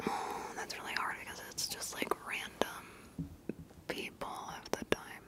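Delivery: very soft, voiceless whispering; faint whisper. Real, not acted. Vlogger mulling over small channels, in a faint whisper. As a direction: drop right down to a barely-there whisper.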